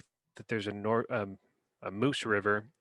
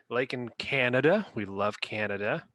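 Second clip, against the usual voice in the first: usual speaking voice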